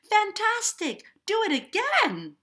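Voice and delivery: very high, loud cheering yell; high-pitched princess shout; excited shout. A narrator's reading. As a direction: shout it with glee, demanding an encore. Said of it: "Elizabeth shouted".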